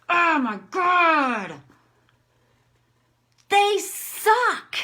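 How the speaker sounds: loud, high-pitched venting; demonstrated anger. Shown as a demonstration. Tapping teacher rants about people who blame her, angry. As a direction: rant at full force, leaning on each phrase. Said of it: "I'm just gonna do anger".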